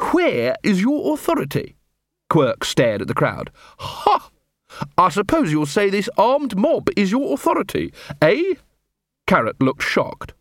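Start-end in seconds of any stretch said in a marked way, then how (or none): 0.1-1.7 high-pitched, affronted demand; indignant challenge. Acted. Captain demanding the corporal's authority, indignant. 3.8-8.5 high-pitched scoffing; scoff, ending on a high jab; sarcastic scorn. Acted. Captain scoffing at the armed mob as authority, sarcastic.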